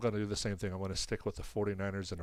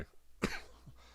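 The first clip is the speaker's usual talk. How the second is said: quiet sick cough; muffled cough from a cold; 4 dB quieter than usual. Real, not acted. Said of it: "I'm coughing on stream".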